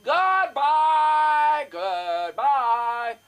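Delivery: high, drawn-out wailing goodbyes; high sing-song mock farewell; mom's dramatic goodbye. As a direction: ham up a dying mother waving farewell. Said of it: "and go".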